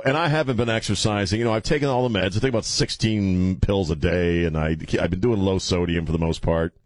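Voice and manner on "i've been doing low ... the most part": ordinary delivery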